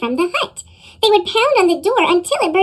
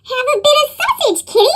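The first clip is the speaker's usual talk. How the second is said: high, screechy little troll voice; little troll screeching at the sleeping bear, high; 9 semitones higher, faster than usual. Acted. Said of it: "and screeched".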